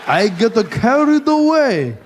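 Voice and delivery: put-on slow, high, rising Japanese accent. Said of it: "with a Japanese accent".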